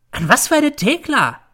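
very high, loud comeback; indignant question. A narrator's reading. Mother answering her accusing son, indignant. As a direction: fire back in protest.

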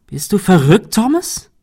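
hohe, laute, fassungslose Frage; verzweifelt, latent wütend. A narrator's reading. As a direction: frag verzweifelt mit unterschwelliger Wut. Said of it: "Shirley klang verzweifelt und latent wütend". (verzweifelt und latent wütend)

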